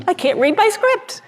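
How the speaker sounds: high, teary laugh while apologizing; laughing through tears. Real, not acted. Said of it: "didn't mean to get so emotional".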